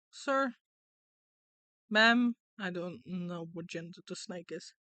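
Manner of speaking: ordinary delivery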